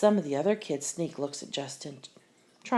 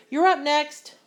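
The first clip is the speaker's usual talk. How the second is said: higher and louder, calling across the court; 9 semitones higher, 7 dB louder than usual. Acted. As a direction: call him from a distance. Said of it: "Emilio calls".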